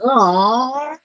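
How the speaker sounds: high, loud drawn-out cry; loud groan. Acted. Game master voicing a character reverting from donkey sounds, loud groan. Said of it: "Felix's regular panting and groaning".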